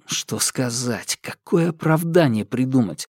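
plain reading voice

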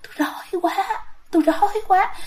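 a hungry ghost tugging at you; ghost moan. Retold or imitated. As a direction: ghost pulls the teacher's legs, moaning. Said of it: "lay kéo chân rồi lay tay vậy nè".

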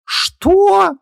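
very high outraged shriek; furious yell. A narrator's reading. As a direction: explode in disbelief on one word. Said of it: "яростно завопил он".

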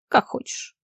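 toneless, nearly voiceless shrug of a line; indifferent. A narrator's reading. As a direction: toss it off with understated indifference. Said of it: "в его голосе прозвучало равнодушие". (равнодушно, почти без голоса)

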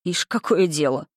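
ordinary delivery, nothing marked

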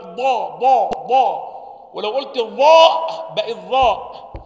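ضا punched loud with tongue pressure; loud slow ضاء pressed forward; pressed dad demo. Shown as a demonstration. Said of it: "الضغط هنا". (ضاد مضغوطة بصوت عالٍ)

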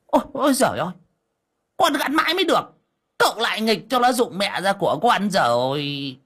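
high whining complaint; high-pitched fretful cry of dismay; dismayed wail. Acted. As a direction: wail over the broken bird. Old man wails over broken carving.

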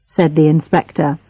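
plain reading voice

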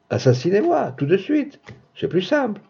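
high, soft woman's voice; defiant retort. Acted. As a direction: lift the voice high and keep it light as you dare him. Woman defies her attacker in story. (réplique de défi, voix haute et légère)

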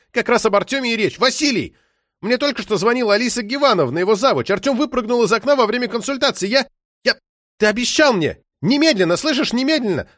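high-pitched, insistent tirade; frantic, furious phone scolding; angry ex-wife. A narrator's reading. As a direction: rant at your ex, pitch way up and demanding. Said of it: "Она была настолько рассержена".

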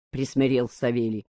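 ordinary delivery

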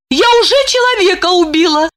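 fast, high horrified gasp. A narrator's reading. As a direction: shudder at the thought. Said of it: "содрогаясь, подумала она".

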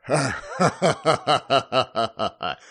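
hammed-up old man's belly laugh; long, slow, acted ha-ha-ha guffaw; loud guffaw. A narrator's reading. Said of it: "заржал громко старик Гордей".

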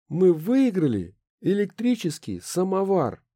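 high, slow, grand proclamation; solemn announcement. Acted. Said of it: "торжественно объявила".

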